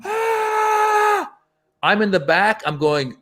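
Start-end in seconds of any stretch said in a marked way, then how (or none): 0.0-1.3 long high mock crowd roar; imitation of an audience screaming; imitated crowd cheer. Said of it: "The crowd".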